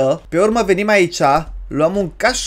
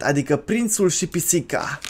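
ordinary talk, nothing marked

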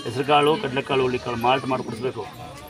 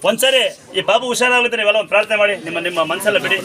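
higher and louder, rallying the crowd to pray; raised preaching voice; 6 semitones higher, 7 dB louder than usual. Real, not acted. Priest calling the crowd to pray for the sick child, raised and loud. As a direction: call the whole room to prayer.

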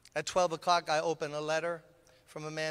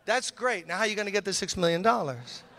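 pressing louder; 4 semitones higher, 7 dB louder than usual. Retold or imitated. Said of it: "He said".

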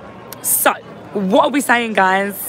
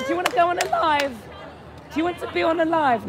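high fast asking; 8 semitones higher, 5 dB quieter, much faster than usual. Real, not acted. Asking strangers to join.

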